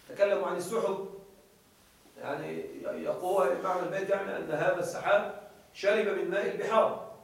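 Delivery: normal talking voice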